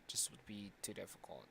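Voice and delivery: unvoiced whisper aside. Real, not acted. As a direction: say it under your breath. Aside to himself.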